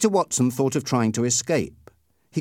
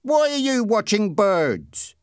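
slow, high-pitched suspicious question; drawn-out, mistrustful challenge; 8 semitones higher, slower than usual. A narrator's reading. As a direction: question him as if he's up to something. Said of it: "Raymond asked suspiciously".

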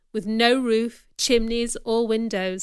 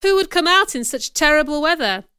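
calling out; 5 semitones higher, 6 dB louder than usual. A narrator's reading. Blind man calling in rain.

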